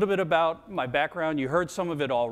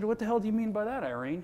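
indignant retort; 7 semitones higher than usual. Retold or imitated. Son snaps back at his mother.

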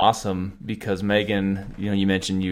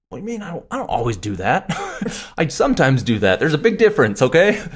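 high, rising flustered denial, getting louder; flustered protest; 8 semitones higher, 7 dB louder than usual. Retold or imitated. Husband protesting to his wife, flustered and defensive. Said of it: "I would get all flustered 'cause I'm like".

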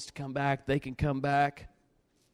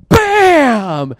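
loud, high "bam" falling away; explosive slap noise; 8 semitones higher, 15 dB louder than usual. Retold or imitated. Telling how Caleb hit harder, explosive impact shout.

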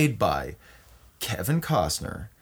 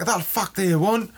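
higher-pitched put-on Scotsman retort; irritated Scotsman; 7 semitones higher than usual. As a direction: snap back as the affronted Scotsman. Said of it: "And then he's like".

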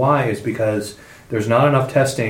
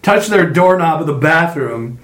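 excited; 6 semitones higher, 4 dB louder than usual. Real, not acted. Laughing rant about dirty phones.

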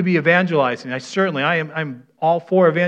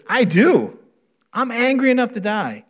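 higher, mock-angry Jonah retort; 8 semitones higher than usual. Jonah snaps back at God, mock angry and higher. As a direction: spit it out like a sulking prophet. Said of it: "he's mad".

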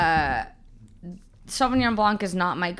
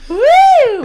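excited cheer; 15 dB louder than usual. Real, not acted. Cheering podcast end.

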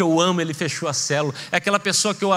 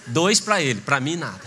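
wry envious grumble; 7 semitones lower than usual. Acted. Pastor's envious aside.

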